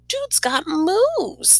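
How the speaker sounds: high, slow, drawn-out admiration; impressed girl. A narrator's reading. Girl admires new friend's soccer tricks, high and slow. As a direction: savour each word, wowed.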